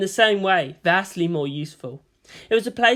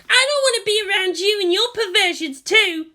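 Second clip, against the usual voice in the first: put-on higher, louder teen yell; shouting girl; 5 dB louder than usual. A narrator's reading. Asuka yells at Misato, higher and louder. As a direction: throw the accusation back at her.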